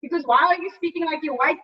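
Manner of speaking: ordinary talk, nothing marked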